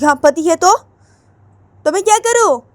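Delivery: high, loud, indignant protest; angry daughter. Acted. Radhika snapping at her mother, high angry protest.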